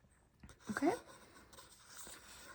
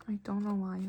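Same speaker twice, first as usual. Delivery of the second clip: ordinary delivery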